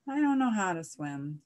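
plain reading voice

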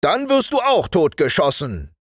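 high, wild threat; heated high-pitched military outburst; wild sergeant. Acted. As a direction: shout the threat as a furious sergeant. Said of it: "rief der Wachtmeister wild".

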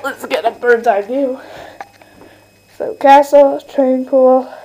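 ordinary talk

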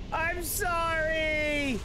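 very slow, sobbing apology; tearful wail of an apology; real crying. Real, not acted. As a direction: choke out the sorry through tears. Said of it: "I'm gonna tear up".

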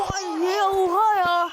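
loud, slow disbelief; can't believe what happened; shocked outburst. Real, not acted. Player shocked at play. (şok olmuş tepki)

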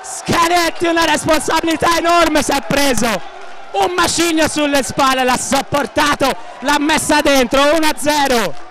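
high-pitched shouted goal call, breathless; breathless italian goal commentary. Acted. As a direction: yell the goal like a stadium commentator. Live goal call, penalty and goal.

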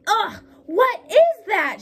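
high, loud, slow yell of disgust; a wife yelling at a sticky mess; disgusted shout. A narrator's reading. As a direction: shout it as the hand hits the blob. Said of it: "she shouted".

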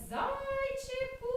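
high, drawn-out playful call. Acted. The mischievous cloud calls down to the bunny, high and slow. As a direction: call to the bunny from the sky, slowly. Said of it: "Вона гукає".

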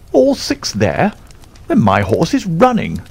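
very high, agitated colonel's cry; astonished, high-pitched exclamation without extra volume; great agitation. A narrator's reading. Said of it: "cried the Colonel in great agitation".

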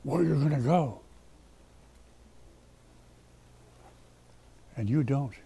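low, hushed and heavy; soft, solemn preacher; grave warning. Real, not acted. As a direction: drop right down for a quiet warning. Grave warning about their fate.

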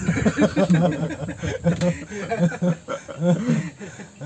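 long chuckling laugh. Real, not acted. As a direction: keep chuckling helplessly. Tertawa menanggapi candaan. (tertawa terkekeh-kekeh)

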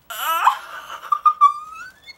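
high shrieking laugh through pain; half laugh, half cry as it rips; pained laugh-scream. Real, not acted. Laughing through pain while peeling a mask off.

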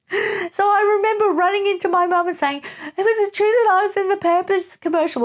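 put-on a small kid running to mum, worried; worried little child. Child asking mum anxiously.

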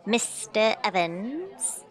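drawn-out, rising "Mister Evans?"; lightly voiced slow hail to the host; slow questioning call. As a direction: call his name slowly, as a question. Calling host at party.

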